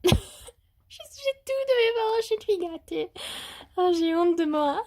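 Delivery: high laughing confession; giggling. Real, not acted. Vlogger admitting her parents spoil her, high giggling. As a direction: giggle through the guilty admission.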